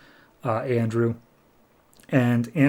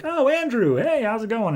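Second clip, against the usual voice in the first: warms up; 10 semitones higher than usual. Acted. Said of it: "immediately the woman, like, warms up to him".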